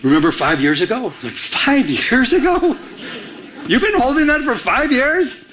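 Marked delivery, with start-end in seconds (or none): 1.3-2.8 high, drawn-out disbelieving echo; retold astonishment, slow and high-pitched; incredulous. Incredulous reaction to an old grudge. 3.7-5.4 high-pitched retold disbelief; mock astonishment at an old grudge, high; incredulous.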